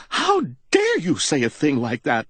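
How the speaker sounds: high, outraged cry; indignant cry. A narrator's reading. Widow outraged by the captain's question, crying out. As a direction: fling the question back at him. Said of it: "she cried".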